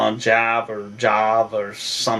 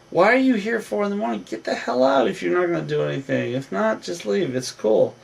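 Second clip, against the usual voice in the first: higher and fast, imitating an irritated roommate; fast, higher mock annoyance; mock exasperated telling-off; 6 semitones higher than usual. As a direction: rattle it off like a fed-up guy shooing someone out. Retelling Paulie kicking girl out.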